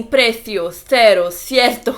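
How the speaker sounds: "Precio, cero, cierto" with a lisped th for c; lisped Spanish th. Retold or imitated. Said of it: "eles falam com esse sotaque meio assim".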